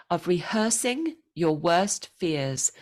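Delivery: rehearsing leaned on, the rest light; stress on rehearsing. Shown as a demonstration. Coach modelling the verb stress for Luis. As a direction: hit the word rehearsing. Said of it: "emphasis, accent on the rehearsing".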